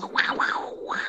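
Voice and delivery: breathy whispered panther call. Retold or imitated. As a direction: imitate a panther's "wow wow". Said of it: "The panther goes".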